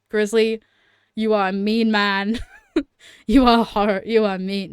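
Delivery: loud, emphatic telling-off, played up; mock scolding. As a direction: scold him loudly in mock outrage. Mock scolding of a video maker.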